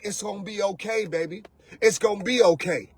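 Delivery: pat him on the back with your voice; mock soothing woman. Woman comforting the crying man.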